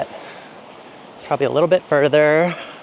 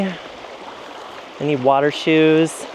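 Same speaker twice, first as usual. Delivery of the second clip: ordinary talk, nothing marked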